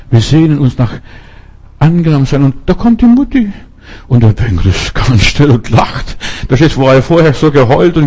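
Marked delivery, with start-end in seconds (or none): 2.4-3.5 excited. Real, not acted. Joyful turn in story.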